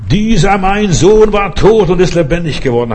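high, loud proclamation; joyful father's cry. Acted. Father in the parable crying out over his lost son, high and loud.

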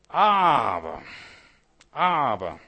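a loud preacher's cry of "Aber"; shouting "Aber, aber" from the pulpit; sudden shout. Real, not acted. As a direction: burst out with a shout.

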